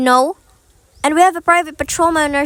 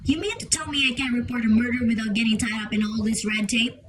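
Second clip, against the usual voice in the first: muttered under her breath, softer, fed up and indignant; exasperated muttering; 3 semitones lower, 8 dB quieter than usual. Acted. Invalid caller, refused by the supervisor, mutters softly in exasperation. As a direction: grumble it half to yourself, furious at the red tape. Refused a call trace, fuming.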